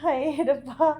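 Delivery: dramatic "oh God" lament; dramatic loud aunty. Real, not acted. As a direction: wail it like a fretting aunty. Self-reproach over wasted milk.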